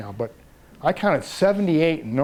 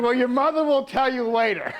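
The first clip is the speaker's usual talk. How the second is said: chuckling through the words, pitched up; laughing; 9 semitones higher than usual. Real, not acted. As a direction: laugh while ducking the question. Dodging a child's awkward question.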